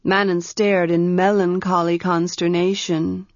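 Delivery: usual speaking voice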